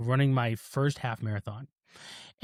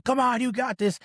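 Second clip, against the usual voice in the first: higher-pitched mock pep talk; pushy encouragement; 8 semitones higher than usual. Father-in-law urging on a flagging runner, pushy. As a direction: push them on like a coach, pitched up. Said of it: "pushing me, like".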